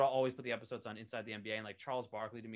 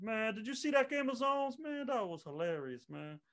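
booming mock Barkley impression; Charles Barkley voice; 11 semitones higher than usual. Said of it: "I should do it in my Charles Barkley voice".

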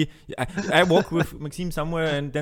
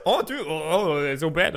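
mock teasing; 2 semitones higher than usual. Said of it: "making fun of each other's accent".

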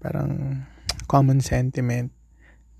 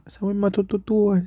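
ordinary talk, nothing marked

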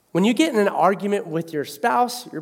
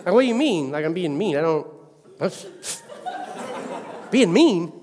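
mock indignant. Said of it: "I went back to my own mode".

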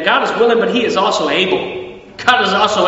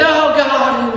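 higher, mildly pleading; mock pleading prayer; 6 semitones higher than usual. People praying doubtfully to God, mock pleading. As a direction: act out a timid plea.

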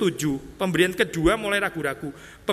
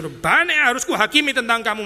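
loud, higher-pitched mock outburst; acted vindictive retort; vengeful; 6 semitones higher, 5 dB louder than usual. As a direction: throw it out as a bitter act of payback. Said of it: "maksudnya kalimat balas dendam begitu".

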